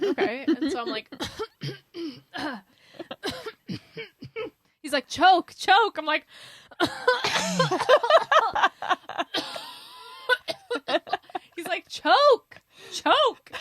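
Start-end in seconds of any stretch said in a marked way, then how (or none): 1.1-2.6 hammed-up choking fit; acted choking. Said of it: "I'll start choking". 5.1-6.0 loud, high mock order; raised, pushy command; insistent command. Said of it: "kept saying it louder and louder". 6.3-11.5 loud, high acted choking fit; over-the-top retching coughs, keeling over; violent choking. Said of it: "like, violently". 11.9-13.5 high, full-force shouted command; loud imitation of an insistent order; louder and louder. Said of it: "kept saying it louder and louder".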